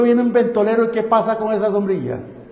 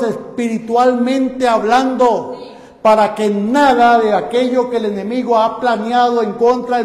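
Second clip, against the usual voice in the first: rising, louder pulpit exhortation, stretching key phrases slowly; fervent preaching; 3 semitones higher, 5 dB louder than usual. Acted. Preacher urging the congregation to seek God daily, fervent preaching. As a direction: drive it home to the congregation.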